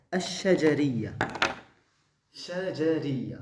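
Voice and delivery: "shajariya" stretched out, each syllable distinct; slow modelling. Shown as a demonstration. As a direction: model "shajariya" slowly for the class. Teacher models the new term. (prononciation lente et détachée)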